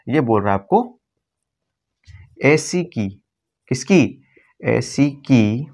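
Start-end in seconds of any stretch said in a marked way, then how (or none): 3.7-5.7 very slow, stretched words; slow emphasis. Shown as a demonstration. Teacher dwelling on the mnemonic words. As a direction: hold each syllable for the class. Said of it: "ये की silent है यहाँ पे".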